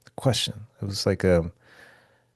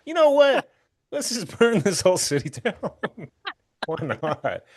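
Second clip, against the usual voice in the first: gleeful arsonist; 8 semitones higher than usual. Pretend. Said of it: "set 'em all on fire".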